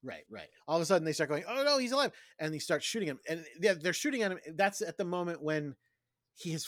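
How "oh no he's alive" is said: mock alarm. Retelling the panicked guards.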